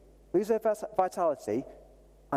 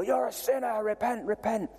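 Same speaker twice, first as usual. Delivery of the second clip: put-on, pitched-up haranguing chant; mock barking preacher; 4 semitones higher than usual. Street preacher accusing people of sin, mock barking. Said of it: "start barking at people".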